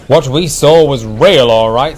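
loud, firm insistence. A narrator's reading. Driver tells the fat controller, firmly. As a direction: insist on it, brooking no doubt. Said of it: "said the driver firmly".

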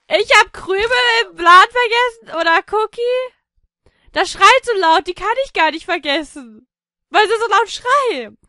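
real excited yell; excited shouting. Real, not acted. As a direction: call it out over the noise. Reacting to noisy pets.

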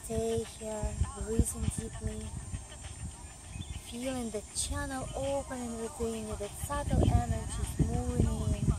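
calm, steady yoga teacher voice; guided yoga instruction. Acted. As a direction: lead the class through the pose. Leads a yoga practice.

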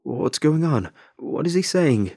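frantic scared calling out. Acted. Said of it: "The man frantically called out".

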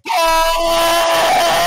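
loud, high cry of yes; triumphant shout. Real, not acted.

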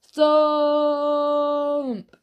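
very drawn-out, high "thump"; long final thump. A narrator's reading. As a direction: stretch the word as one last heave. One last try at the door.

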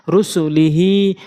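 slow রুসু লি হি, হি held long; drawn-out madd. Shown as a demonstration. Teacher applying madd in a word, drawn-out ending. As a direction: stretch the final হি of রুসু লি হি. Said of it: "প্রয়োগ করে পড়ব".

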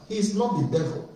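ordinary delivery

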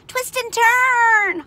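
loud, high honk with long last word; drawn-out goose yell. A narrator's reading. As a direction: honk it out, stretching the end. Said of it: "honked the goose".

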